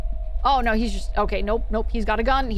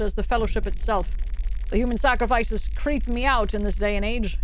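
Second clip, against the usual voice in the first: narration; 4 semitones lower than usual. Acted. Reads in-game journal text.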